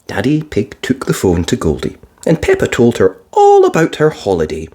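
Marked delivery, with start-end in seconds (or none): none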